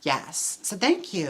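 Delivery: ordinary talk, nothing marked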